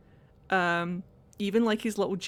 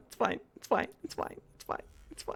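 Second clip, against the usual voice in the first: softer and softer until a whisper; fading resignation; 9 dB quieter, faster than usual. Real, not acted. Gamer resignedly dropping into a whisper. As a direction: let it fade away.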